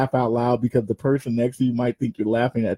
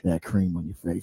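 lower, softer, fast sleazy voice; sleazy caller; 10 semitones lower, 6 dB quieter than usual. Retold or imitated. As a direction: drop low and slide it in like a dirty joke. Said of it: "They'll be like".

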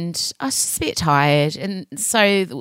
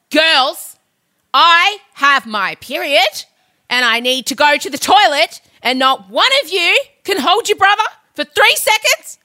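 exasperated mother; 10 semitones higher than usual. Retold or imitated. Mother scolding daughters who won't hold their brother, exasperated. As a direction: let the exasperation climb with each demand.

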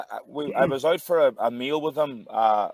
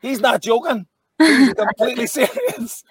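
high and loud through laughter; half laughing, half alarmed; giggly aside in disbelief; 11 semitones higher, 5 dB louder than usual. Retold or imitated. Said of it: "I'm like".

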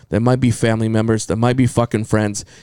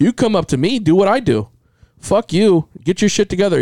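higher, fired-up telling off; tough talk; 8 semitones higher than usual. Acted. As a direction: lay down the law. Telling off people who drag you down.

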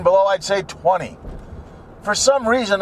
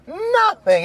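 Nothing much higher, falling hard; emphatic higher repeat; 12 semitones higher than usual. Real, not acted. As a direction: hit Nothing up high and let it drop.

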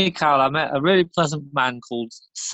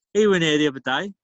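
ordinary talk, nothing marked

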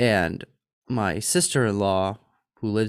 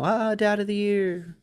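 high, held-out heavenly fanfare; mock acclaim; 10 semitones higher than usual. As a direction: crown yourself the hero, high and airy.

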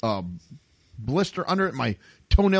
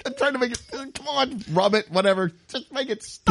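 high strained mock desperation; desperate pained plea; 9 semitones higher than usual. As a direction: beg for the cramp to stop. Retelling fighting a foot cramp.

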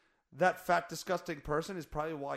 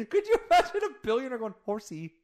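laughing; 11 semitones higher, 9 dB louder than usual. Real, not acted. Laughing, high.

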